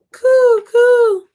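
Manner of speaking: high, loud cooing pigeon. Pretend. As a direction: coo like a city pigeon. Pigeon sounds in book.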